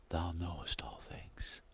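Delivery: slow, whispered confession; humbled whisper. Acted. Peter humbled, quoted softly.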